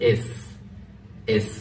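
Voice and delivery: "এস" with the tongue tip placed; careful S. Shown as a demonstration. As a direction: set the tongue tip, then hiss "এস". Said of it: "জিহ্বার আগাটাকে এখানে লাগাবেন". (জিহ্বার আগা লাগিয়ে "এস")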